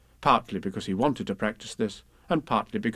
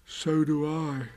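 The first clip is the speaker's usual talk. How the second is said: sadly; 3 semitones higher, much slower than usual. Acted. Pooh answers Rabbit, slow mild sadness. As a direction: sigh it out gloomily. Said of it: "And Pooh said sadly".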